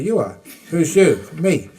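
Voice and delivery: loud teasing lines breaking into a laugh; laughing retorts. Retold or imitated. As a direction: tease him, then laugh. Replaying debate, laughing.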